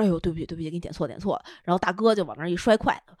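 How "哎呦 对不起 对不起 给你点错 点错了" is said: soft, sheepish sorry; meek, quiet sorry to her husband; quiet apology. Retold or imitated. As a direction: mumble the apology softly. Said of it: "我说".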